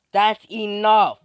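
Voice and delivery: slow, high, very loud order; stern command. Acted. Melio breaking up the quarrel, commanding shout. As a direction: shout over a squabble to stop it.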